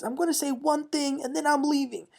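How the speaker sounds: high-pitched mock-upset announcement; Kyle. Kyle outside after storming out, mock crying. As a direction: lightly imitate a worked-up, tearful exit line. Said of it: "she's screaming and crying".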